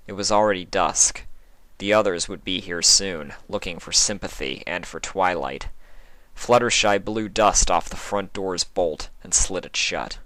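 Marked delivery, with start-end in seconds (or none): none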